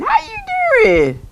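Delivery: very high and drawn out, far up; high stretched hello; slow squeaky greeting. Acted.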